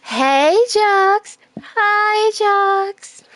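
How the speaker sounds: put-on high, loud girly bee greeting; girl bee voice. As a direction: squeal a hello like a smitten girl bee. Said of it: "I have to sound like a girl bee".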